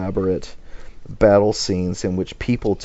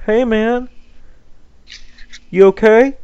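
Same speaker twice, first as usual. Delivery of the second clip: high, loud, comically casual check-in; high-pitched mock concern; Apollo; 7 dB louder than usual. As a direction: pipe up high like a clueless buddy. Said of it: "Apollo wanders up in the book fifteen".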